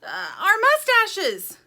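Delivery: hesitate, then high, loud, drawn-out shout; shouted idea. A narrator's reading. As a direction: blurt out a desperate idea. Dirt shouts a frantic idea.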